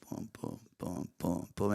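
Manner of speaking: very soft, hushed booms; low, barely voiced footfall effect; walking sounds under the breath; imitated footsteps. Imitates walking over to them.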